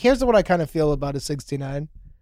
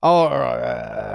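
low, drawn-out mock fizzle; accent giving up; 7 semitones lower, slower than usual. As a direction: imitate an accent attempt dying, slow and low. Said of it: "then he goes like this".

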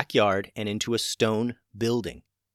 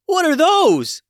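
panicked high shriek; yelling; 11 dB louder, slower than usual. A narrator's reading. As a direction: shriek it in alarm. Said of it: "yelled Gwen".

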